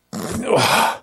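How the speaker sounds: long high sigh of defeat; heavy sigh. Retold or imitated. Prisoner facing protective custody, heavy resigned sigh. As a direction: let out a weary, resigned sigh. Reacting to being sent back.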